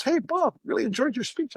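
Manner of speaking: high, friendly imitation of a fellow speaker; imitating Jim. Fellow speaker greeting Bob after his talk, high mock voice. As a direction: pitch it way up as the other guy. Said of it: "He kinda goes".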